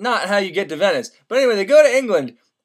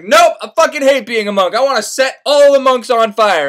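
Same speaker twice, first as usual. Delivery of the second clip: loud, raised-pitch rant; venting monk; 4 semitones higher, 7 dB louder than usual. Retold or imitated. Monk answers Candide's guess, loudly fed up. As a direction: vent it loudly, pitch pushed up. Monk vents hatred of his life.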